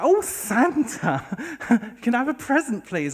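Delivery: high, loud, gushing flirt; tipsy cougar. Retold or imitated. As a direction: coo loudly at Santa, drawing out the opening. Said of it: "what I can only describe as tipsy cougars".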